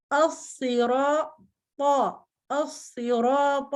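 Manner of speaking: असिरात slow with thick saad and heavy ta. Shown as a demonstration. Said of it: "तो कैसे?".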